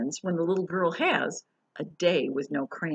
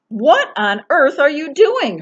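louder, higher shocked mother; mother crying out; 10 semitones higher, 13 dB louder, slower than usual. Acted. Mother catching her daughter drawing on walls, loud. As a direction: call out like a startled mom. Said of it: "her mother cried".

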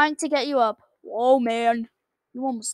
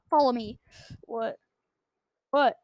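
ordinary talk

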